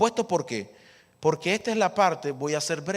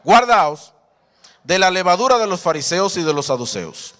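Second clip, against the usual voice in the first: loud repeated warning; loud call and response; 9 dB louder than usual. Shown as a demonstration. Preacher leading the congregation to repeat, loud. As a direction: lead the congregation in a loud chant. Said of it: "repita conmigo".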